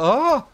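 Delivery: sudden high shriek; startled scream. Real, not acted. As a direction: yelp in sudden fright. Startled by monster in game.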